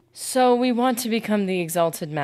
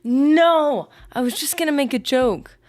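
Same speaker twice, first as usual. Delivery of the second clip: higher and louder, defensive no; pushing back on chat's teasing; loud protest; 9 semitones higher, 8 dB louder than usual. Real, not acted. Streamer protests to chat that it was only a joke, loud.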